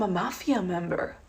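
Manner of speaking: soft, hushed earnest asking; imitating a curious stranger, softly; earnest question. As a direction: ask it softly, as a sincere question. Guy's naive question, then echoed back.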